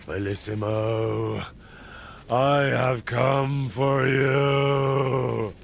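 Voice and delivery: slow, ominous death voice; grim reaper. Acted. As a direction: loom over him, drawn out.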